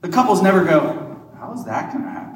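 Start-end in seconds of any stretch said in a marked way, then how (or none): 1.4-2.3 breathy whispered mock question, barely audible. Priest mimics a puzzled engaged couple, in a whisper.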